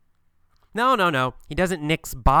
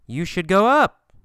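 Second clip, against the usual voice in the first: "up" swoops upward, higher and louder; rising intonation; 4 semitones higher, 5 dB louder than usual. Shown as a demonstration. Teacher has students copy the tune, rising high on "up". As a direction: end high, lifting "up". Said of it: "When I go up".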